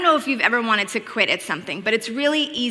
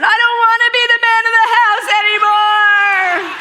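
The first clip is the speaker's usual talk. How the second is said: loud, high mock wail of a kid giving up; hammed-up shouted whine, much higher; crying out; 11 semitones higher, 8 dB louder than usual. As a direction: mimic a child wailing in full meltdown. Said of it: "he just cries out".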